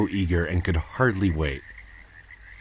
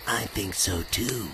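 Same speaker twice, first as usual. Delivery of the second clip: softer, hushed; whispered; 6 dB quieter than usual. Acted. Zeeby answering Tigus while secretly listening, softly. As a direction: murmur it so they aren't heard. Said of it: "whispered Zeeby".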